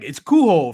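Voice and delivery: "Cujo" pronounced koo-ho, silent J. Retold or imitated. Retelling the book's origin, "Cujo" as koo-ho. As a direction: say "Cujo" with an H in place of J. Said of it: "supposed to be pronounced Cujo".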